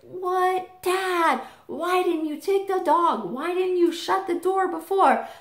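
put-on high, pleading child voice; high kid whine at dad; whiny little kid. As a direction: complain to dad like a disappointed kid. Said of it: "I was like".

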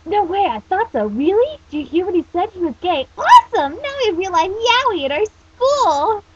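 high, loud fangirl squeal; Renge. Acted. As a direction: shriek with over-the-top anime excitement. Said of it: "that's supposed to be Renge speaking".

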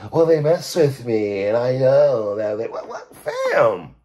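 mocking, drawn-out put-on voice; funny voice. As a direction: stretch it out in an annoying funny voice. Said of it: "That funny voice and shit".

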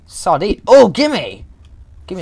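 high, loud and greedy; excited grabbing cry. Pretend. Gamer lunging after a sardine, excited yell. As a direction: shout it as you lunge for the prize.

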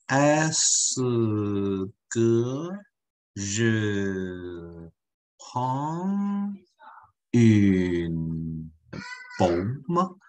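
very slow, each word dragged out in a mock slow-motion delivery; absurdly slow mock question, words pulled far apart. Shown as a demonstration. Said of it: "电视里的慢动作".

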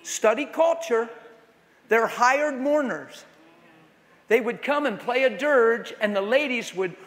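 ordinary talk, nothing marked

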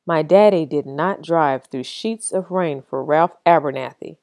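ordinary delivery, nothing marked

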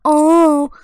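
loud hammed-up bragging; mock bragging. Mocking a friend's goofy boasting, hammed-up loud brag. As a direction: show off like a goofy champ, mock style. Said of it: "and then go".

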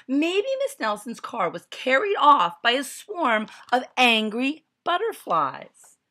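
high, loud eager voice; know-it-all exclaiming. A narrator's reading. Know-it-all kid exclaiming a theory, high and loud. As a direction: blurt it out like the class know-it-all. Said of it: "exclaimed one know-it-all".

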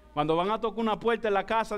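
ordinary talk

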